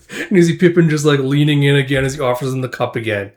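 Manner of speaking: plain reading voice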